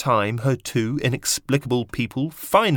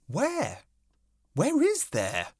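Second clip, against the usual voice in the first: higher insistent question; puzzled bug; 12 semitones higher, slower than usual. A narrator's reading. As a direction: press it, high and puzzled. Bugs repeat question.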